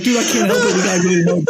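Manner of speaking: high, laughing helpless confession; laughing excuse. Retold or imitated. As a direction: laugh through the guilty excuse. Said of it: "I was like".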